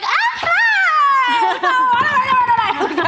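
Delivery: high, loud unhinged chirp; manic. Retold or imitated. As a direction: bounce off the walls, wired. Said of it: "Pip was manic".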